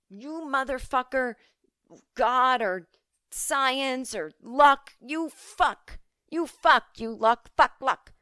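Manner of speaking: furious yelling at the universe; angry rant. Retold or imitated. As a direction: rage at God or luck. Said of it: "I was like".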